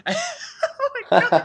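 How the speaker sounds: very high, loud and slow, laughing close to tears; laughing, overwhelmed. Real, not acted. Overwhelmed laughing squeal recalling the Shenmue three reveal. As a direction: let the joy crack into laughter.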